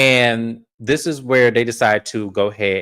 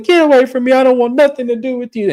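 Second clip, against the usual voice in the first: high, loud mock shriek; outraged rejection; 11 semitones higher, 4 dB louder, faster than usual. Lisa pushes Heather away, outraged. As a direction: imitate a hysterical brush-off.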